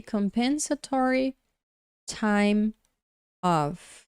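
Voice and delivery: careful English model of "compensatory time off"; pronunciation demo. Shown as a demonstration. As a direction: say "compensatory time off" slowly and clearly for learners. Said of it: "Pronuncia-se".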